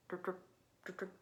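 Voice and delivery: ordinary delivery, nothing marked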